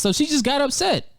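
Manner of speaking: normal talking voice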